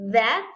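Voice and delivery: "that" with a buzzing voiced th. Shown as a demonstration. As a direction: let the th in "that" vibrate. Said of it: "con la vibración".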